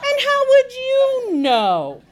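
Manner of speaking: very high, loud, drawn-out question; incredulous challenge. A narrator's reading. Friend challenging Paula about knowing the motel, incredulous. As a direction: pounce on it, stretching the words high.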